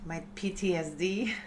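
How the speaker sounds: ordinary talk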